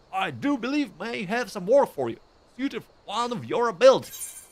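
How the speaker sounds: high-pitched, hammed-up American accent; bad American accent. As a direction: play an old station master in a badly faked American accent. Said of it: "that is my bad American accent".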